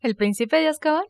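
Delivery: high incredulous echo. Real, not acted. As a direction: repeat it in disbelief. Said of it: "incredulous echo of the slip".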